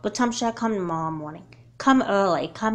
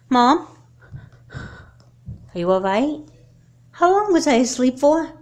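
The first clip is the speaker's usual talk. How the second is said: high, loud, jolted; just woke with a start; startled awake; 6 semitones higher, 7 dB louder, slower than usual. Acted. Daughter jolting awake from a nightmare, startled.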